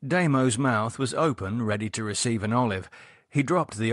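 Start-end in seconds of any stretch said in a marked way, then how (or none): none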